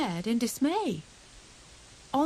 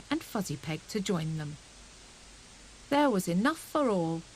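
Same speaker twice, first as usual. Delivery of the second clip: ordinary delivery, nothing marked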